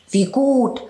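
the mouse pleased for her own reasons; sly field mouse. Acted.

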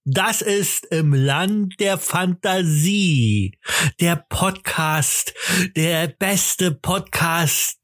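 boxing ring announcer, drawn out; lucha libre announcer. Acted. Ringsprecher kündigt Folge an. (Ringsprecher-Ansage)

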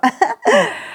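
squeaky puppet giggle; high giggle. Acted. Puppet laughs.

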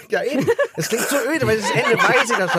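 high-pitched, laughing through the words; can't help laughing while explaining; amused, speech breaking into laughter. Real, not acted. Amused at giving away the ending.

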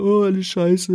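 hammed-up whining; mock whining.

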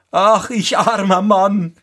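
high-pitched carter's wail; lamenting cry. A narrator's reading. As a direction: call out in self-pity, pitched high. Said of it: "rief er". (hoher Klageruf)

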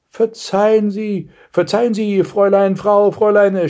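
high, flustered stammered apology; stammering. A narrator's reading. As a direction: stumble over the form of address. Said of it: "stotterte Blaustrümpfchen". (verlegenes Stottern, hohe Stimme)

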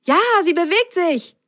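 high, happy cry; delighted. A narrator's reading. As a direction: cheer with delight. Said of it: "freute sich Ron".

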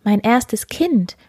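the daughter is shocked by the little man's price; shocked disbelief. Acted. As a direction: recoil at the demand.

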